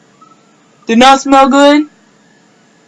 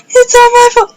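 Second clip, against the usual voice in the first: higher, sobbing it out; crying self-blame; 12 semitones higher, 3 dB louder than usual. Retold or imitated. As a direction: wail the guilt through tears. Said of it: "I am full out crying".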